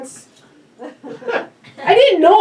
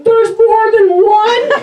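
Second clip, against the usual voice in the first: laughing quote; 3 semitones higher, 5 dB louder, slower than usual. Retold or imitated. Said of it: "I'm like".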